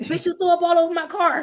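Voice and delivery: scolding friend. Retold or imitated.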